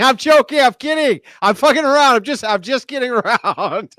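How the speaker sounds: high, laughing through the words. Real, not acted. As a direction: laugh it off loudly. Laughing while backpedaling.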